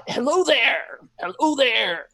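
high, loud hail; voice calling from the swamp. Acted. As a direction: call out a greeting across a swamp. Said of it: "they hear a voice".

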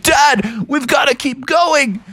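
high-pitched, acted urgent cry; urgent plea. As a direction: urge Dad on through danger. Urgent cry amid lightning.

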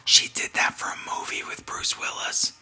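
a real whisper, no voice at all; hushed gossip to the co-host; whispered aside. Real, not acted. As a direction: whisper it like a secret behind your hand.

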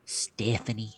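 whispered warm-up of a character voice; hushed practice take; getting into character. Acted. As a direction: whisper the name, trying out the character. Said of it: "let me get in a character here".

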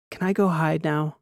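a hint of a scared doctor; doctor asks Transom to hide, mild nervous voice; Wren's voice. A narrator's reading. Wren voiced, wants to hide.

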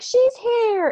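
high, bright cry of she's here; excited announcement. Retold or imitated. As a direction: announce it like a thrilled new mother. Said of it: "And I'm like".

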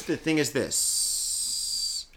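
slow, whispered "the thing is this"; hushed. Real, not acted. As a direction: lean in and whisper it slowly.

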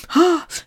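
high, audible intake of breath; acted-out gasping breath; sharp gasp. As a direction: suck in air suddenly.

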